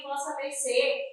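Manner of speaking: ordinary talk, nothing marked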